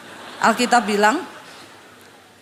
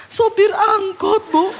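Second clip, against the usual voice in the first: high, drawn-out tearful whine; mock wailing; 7 semitones higher, 3 dB louder, slower than usual. Absurd answer to pastor's question, mock wail. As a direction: stretch it out like a sob.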